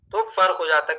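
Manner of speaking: normal talking voice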